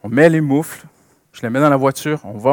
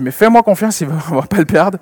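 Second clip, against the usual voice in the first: higher, louder coaxing; father reassuring; 4 semitones higher, 13 dB louder than usual. Retold or imitated. Father coaxing his daughter to trust him, louder. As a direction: coax the child to trust him. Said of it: "Je dis".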